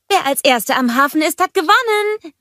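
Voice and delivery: high, rising taunt over distance; calling back. A narrator's reading. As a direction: shout it back mid-race. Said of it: "rief Anna zurück". (hoch und triumphierend zurückgerufen)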